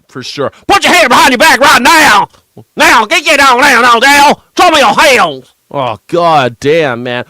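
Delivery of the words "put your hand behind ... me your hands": loud, high Southern cop yelling orders; Southern cop shout. Retold or imitated. Streamer hamming up a Southern cop screaming at a suspect. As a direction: scream the commands like a Kentucky trooper. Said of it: "an accent really does carry".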